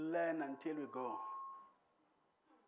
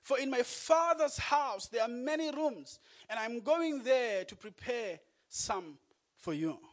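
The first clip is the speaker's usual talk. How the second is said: higher, louder, building to a shout; full-force preaching; 5 semitones higher, 5 dB louder than usual. Acted. Preacher proclaiming Jesus' promise of rooms to the congregation, loud. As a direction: preach it out over the room.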